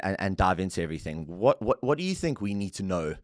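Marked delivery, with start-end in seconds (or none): none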